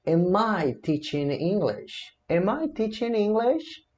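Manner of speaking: "Am I teaching English" very slow, second take rising high; questioning intonation. Shown as a demonstration. Teacher modelling self-questioning, "Am I teaching English" slowly in question tune. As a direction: draw out the question, lifting "English". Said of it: "Agora eu vou me questionar".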